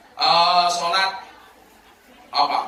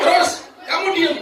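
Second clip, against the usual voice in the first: high-pitched, incredulous outburst; acted indignant husband; outraged husband; 12 semitones higher, 4 dB louder than usual. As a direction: demand an answer in shrieky disbelief.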